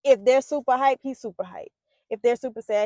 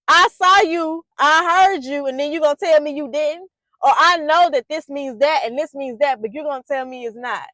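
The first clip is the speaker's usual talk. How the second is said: voicing the child; 3 semitones higher, 8 dB louder than usual. Child confronting lying adult.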